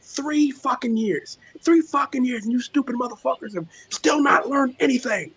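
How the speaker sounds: high-pitched, fed-up tirade at the idiots; exasperated doctor rant. Retold or imitated. Doctor cussing out a conspiracy guy on Clubhouse, high exasperated rant. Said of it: "She's like".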